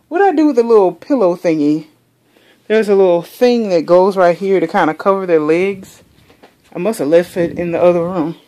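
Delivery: ordinary talk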